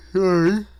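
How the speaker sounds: big yawn breaking into sorry; loud yawn. Real, not acted. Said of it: "Stop yawning".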